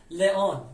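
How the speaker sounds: لئون in two syllables, le-on; l sound. Shown as a demonstration. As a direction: say لئون opening on a clear l. («لئون» با صدای ل)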